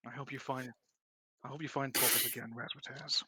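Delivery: sad farewell. Acted. Lance watches Razzmatazz walk away, quiet sad farewell. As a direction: say a quiet, wistful goodbye.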